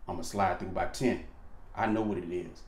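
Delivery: low cool confirmation. Retold or imitated. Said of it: "Then you let her know".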